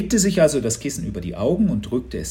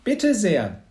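high, loud, brisk little speech; bright-voiced girl; 11 semitones higher, 6 dB louder than usual. A narrator's reading. Girl handing round cups at night, bright high voice. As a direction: address everyone in a bright high voice. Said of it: "mit heller Stimme".

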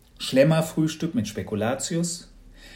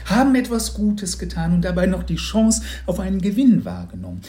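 high, loud, over-the-top saleswoman voice; a disguised neighbour laying on the cheer; hammed-up gushing congratulations; gushing lottery lady; 6 semitones higher, 4 dB louder than usual.

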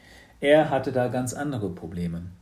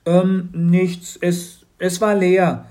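put-on higher, louder, halting boy's voice; Matz lying nervously; 8 semitones higher, 10 dB louder, slower than usual. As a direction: stammer out a lie. (stockend lügender Junge)

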